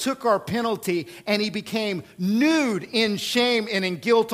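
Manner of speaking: high, urgent sermon climax; impassioned preaching. Real, not acted. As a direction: preach it loud, building through punched phrases. Preaches at full force.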